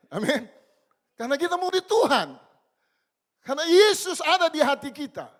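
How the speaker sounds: loud, high, fervent preaching to the church; preaching at full force. Acted. Preacher exhorting the church about obedient Yusuf, loud and fervent. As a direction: hammer each phrase at the congregation.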